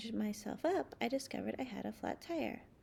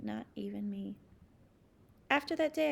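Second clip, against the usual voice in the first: ordinary delivery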